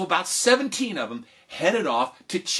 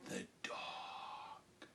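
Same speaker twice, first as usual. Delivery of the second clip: hushed reveal; 21 dB quieter than usual. A narrator's reading. Dramatic reveal after pause.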